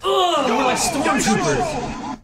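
loud, slow laughing groan; laughing shout. Real, not acted. Laughing complaint at missed shot.

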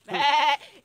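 loud drawn-out bleat; goat bleat. Acted. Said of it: "come fanno le caprette".

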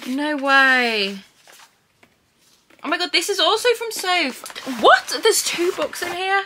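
squealing high with disbelief; high-pitched surprise. Real, not acted. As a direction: shriek it out in real shock. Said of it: "Excuse my, like, high-pitched weird voice then".

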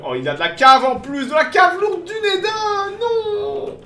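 high, slow, anguished shout; dismayed yell. Real, not acted. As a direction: cry out in real dismay. Sudden enemy heavy cavalry appears.

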